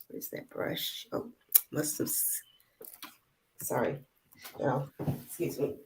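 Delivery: very soft, half-voiced muttering to oneself; absent-minded aside. Real, not acted. Presenter hunting for a mislaid brush, muttering to herself. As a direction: mutter it away from the listeners.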